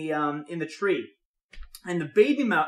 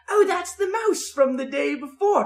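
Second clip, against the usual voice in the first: imitated mother owl; 7 semitones higher than usual. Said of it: "And the mother goes".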